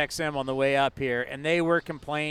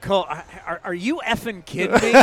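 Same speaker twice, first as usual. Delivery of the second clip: incredulous yell, rising high; pretend-furious voice in the earpiece; a teasing rant at the pitcher over a hung pitch; mock outraged coach; 4 semitones higher, 6 dB louder than usual. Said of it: "Have my voice on there and go".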